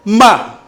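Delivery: "Ma" short, starting high and falling sharply; fourth tone. Shown as a demonstration. Teacher demonstrates the last tone, falling "Ma". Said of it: "le dernier ton".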